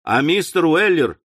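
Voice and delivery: usual speaking voice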